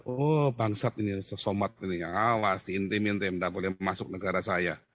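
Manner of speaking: lightly voiced disapproving world, low and muted; mock stern verdict. The world turning away an unwanted visitor, mock stern.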